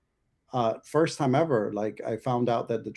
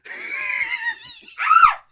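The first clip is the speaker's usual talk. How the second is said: high burst of laughter; 6 dB louder than usual. Real, not acted. Laughing at own bit.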